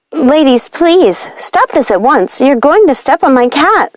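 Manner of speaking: put-on understated snappish girl; snapped. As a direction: scold the stomping witches sharply. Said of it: "snapped Tulip".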